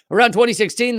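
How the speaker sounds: ordinary talk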